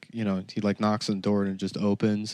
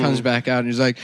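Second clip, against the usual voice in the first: ordinary talk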